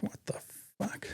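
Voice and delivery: a breathy whispered what the fuck, barely voiced; shocked whisper. Soldier whispering his shock at the complaint. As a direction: hiss it under your breath in disbelief.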